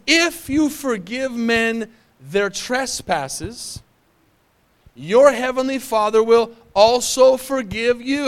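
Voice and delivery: slow, deliberate verse reading; slow scripture reading. Acted. Pastor reads Jesus' words to the church, slow and solemn. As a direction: give each word of the verse weight.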